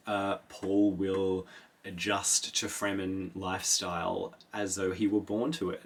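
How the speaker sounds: ordinary talk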